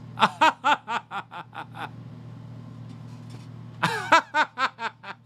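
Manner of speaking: high, airy posh laugh; hammed-up snooty laugh; fake rich laugh. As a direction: laugh like a pretend millionaire. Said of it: "I'm working on my rich laugh".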